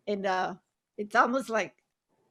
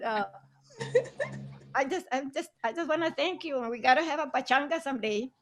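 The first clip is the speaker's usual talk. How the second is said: normal talking voice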